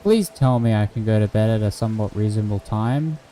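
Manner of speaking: ordinary delivery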